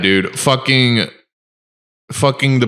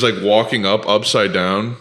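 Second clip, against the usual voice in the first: ordinary talk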